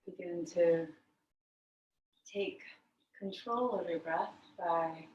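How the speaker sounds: calm meditation voice with very long pauses; guided meditation. Real, not acted. Yoga teacher guiding the class to notice breath, guided meditation. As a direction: lead them inward, leaving long silences between phrases.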